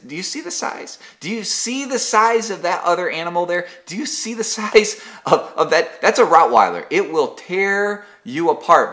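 high, incredulous talking-down to a dog; exasperated scolding. Pretend. Pastor scolding his yapping Pomeranian, exasperated and high. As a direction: scold the silly pup, half laughing.

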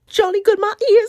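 very high, loud mock British accent; silly British accent, high.

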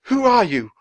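high, loud, frightened challenge; alarmed cry. A narrator's reading. Charles to the silent third ghost, crying out. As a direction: cry out at the intruder. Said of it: "Charles cried".

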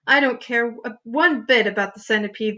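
usual speaking voice

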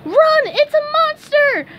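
very high, loud panicked squeal; high-pitched cartoon alarm; kids crying out. A narrator's reading. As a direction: shriek like frightened children. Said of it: "the kids cried".

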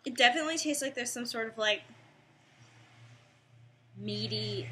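eating a snack; chewing while talking. Real, not acted. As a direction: mouth partly full. Seen 0: Just ate chips; mouth moves like chewing early in words.

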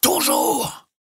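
high, strained, breathy cry; forceful shout. A narrator's reading. The captain answers Jukes with great energy. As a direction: hurl the word against the wind. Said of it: "prononcé avec une extrême énergie".

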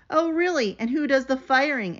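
defiant dragon retort; glaring retort. Acted. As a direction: fire it back at him. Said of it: "with a glare".

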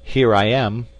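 loud, firm reply; calling back. A narrator's reading. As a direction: announce yourself to someone far off. Companion calls back across the abyss.

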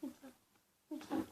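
hushed repeated begging; whispered pleading. Real, not acted. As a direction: whisper please over and over. Begging before last pick.

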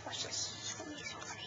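hushed whisper while the show plays. Real, not acted. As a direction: whisper so the clip can be heard. Whispering before clip plays.

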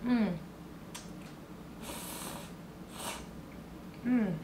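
slurping noodles while humming; mumbled through chewing; mouth full of noodles. Real, not acted. Seen 1: Noodles in her mouth, slurping, then chewing with closed lips throughout.

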